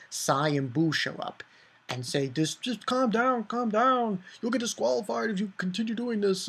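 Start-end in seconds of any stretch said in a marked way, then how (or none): 2.3-6.5 high, hurried placating voice; a higher put-on voice, speeding up into the warning; lightly voiced intervening fighters. Two fighters tell brawlers to stop, high placating voice. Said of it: "two people from the Kano country".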